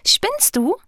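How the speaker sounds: very high, incredulous protest; indignant question. A narrator's reading. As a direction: squeal the question in disbelief. Tickled heart protests indignantly.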